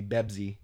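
"Bebsi" with B sounds replacing both Ps. Shown as a demonstration. Example of Arabic speakers' P, "Bebsi" with Bs. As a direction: say Pepsi as "Bebsi". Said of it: "it's a B".

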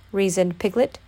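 plain reading voice

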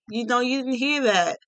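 ordinary talk

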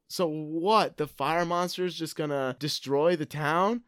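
ordinary delivery

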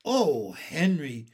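plain reading voice

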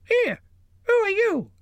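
very high squeak of a talking purse. A narrator's reading. The troll's purse catching Bilbo, squeaking very high. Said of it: "it squeaked".

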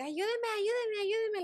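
high, slow mock cry for help; a retold plea, pitched high and drawn out; imitating a scream for help. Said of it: "like, gritando y gritando". (grito de auxilio imitado, agudo y lento)

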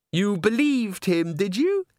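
very high, slow, patronising question; incredulous diva. A narrator's reading. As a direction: draw it out in a high girlish voice. Christine mocks Agnes for believing.

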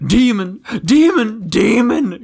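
high, loud theatrical cries; shrieking. A narrator's reading. Prince shrieks at Aslan in the story, shrieking. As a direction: hurl each word out as a shrill accusation. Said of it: "shrieked the prince".

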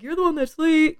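loud, high-pitched imitation of a cheeky kid; mock accusing. Student answering back to worker, loud mock accusing. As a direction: throw it back loudly, mimicking a student.

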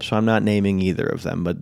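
ordinary talk

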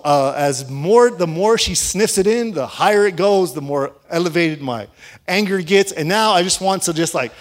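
ordinary talk, nothing marked

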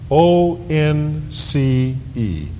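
slow, deliberate spelling of once; spelled out letter by letter. Shown as a demonstration. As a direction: say "O N C E" slowly, each letter apart. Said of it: "That one word".

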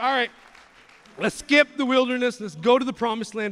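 loud, high, impatient cry; rushing to skip ahead; eager shout. Retold or imitated. Israelites begging to skip the wilderness, loud and eager.

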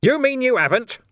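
loud, high-pitched bellow; explosive shouted question; outraged roar. A narrator's reading. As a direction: roar it in disbelief. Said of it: "Vimes roared".

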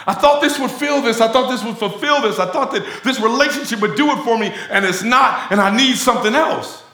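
hammed-up restless craving; mock addict's lament. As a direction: wail that nothing fills you. Voicing spirit of addiction.

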